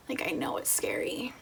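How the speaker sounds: hushed and tender, almost breathless; soft reassuring whisper. Retold or imitated. Husband quietly reassuring his frightened pregnant wife, soft whisper. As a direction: whisper it gently, like comforting someone scared. Husband comforting her, quoted.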